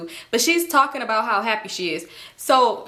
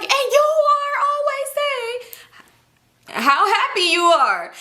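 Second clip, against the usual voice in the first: hammed-up angry outburst; mock yelled accusation; 13 semitones higher than usual. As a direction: stretch it into an exasperated mock yell. Acted yelling accusation in quarrel.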